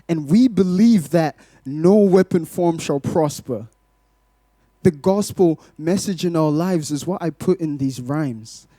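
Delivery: ordinary talk, nothing marked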